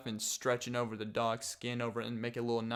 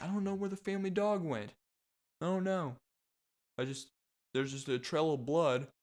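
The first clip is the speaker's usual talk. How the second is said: higher, quick, airy shrug of a voice; clueless parent; 5 semitones higher than usual. Retold or imitated. Vlogger mocking a parent about the missing family dog, higher and airy. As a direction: play a parent who has no idea what happened. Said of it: "Just like".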